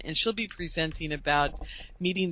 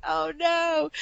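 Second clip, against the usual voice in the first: dismay; 10 semitones higher than usual. Retold or imitated. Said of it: "I was like".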